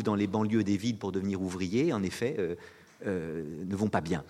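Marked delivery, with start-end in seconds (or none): none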